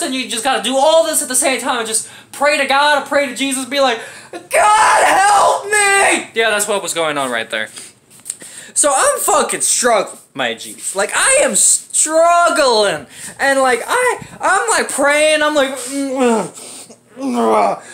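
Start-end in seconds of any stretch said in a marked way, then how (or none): 4.3-6.3 high, loud, crying plea to God; desperate cry for help. Retold or imitated. Straining on the toilet, he cries out to God loudly. 15.7-17.8 short strained grunts, pushing hard; straining grunts. Retold or imitated. Constipated on the toilet, he lets out straining grunts. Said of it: "I don't know why I'm doing so many grunts".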